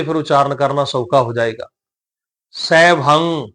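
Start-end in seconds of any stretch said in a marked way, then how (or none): 2.5-3.5 ਸੈਭੰ closing on a held nasal ng; nasal pronunciation. Shown as a demonstration. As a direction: let ਸੈਭੰ end through the nose, no g. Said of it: "ਨੱਕ ਦੇ ਵਿੱਚੋਂ ਆਵਾਜ਼ ਆਏਗੀ". (ਸੈਭੰ ਦੀ ਆਖ਼ਰੀ ਆਵਾਜ਼ ਨੱਕ ਵਿੱਚੋਂ)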